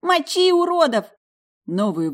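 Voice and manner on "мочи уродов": excited shout. A narrator's reading. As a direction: shout with reckless glee. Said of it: "с азартом кричала".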